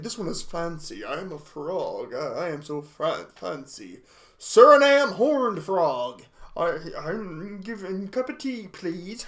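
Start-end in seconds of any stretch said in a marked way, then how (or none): 0.0-4.0 a softer, lower, snooty frog voice; fancy frog. Pretend. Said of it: "this one has an attitude". 6.6-9.2 put-on a lightly done British accent, softer; a hint of a British accent, asking for tea. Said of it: "He looks British".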